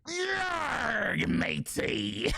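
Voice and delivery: high shouted pirate cry; over-the-top pirate. Shown as a demonstration. Teaching pirate voices, shouting a full-out pirate cry. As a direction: go all out as a pirate. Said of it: "you really wanna get into character".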